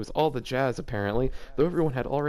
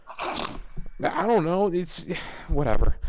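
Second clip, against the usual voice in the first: exasperated; 3 semitones higher, much slower than usual. Real, not acted. Giving up on the story.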